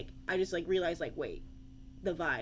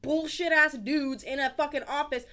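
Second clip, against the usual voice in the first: fired-up shouting, higher and louder, slowed; angry rant about wars, shouting; yelling; 8 semitones higher, 7 dB louder than usual. Real, not acted. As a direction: rant at full volume, all fired up. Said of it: "Stop yelling".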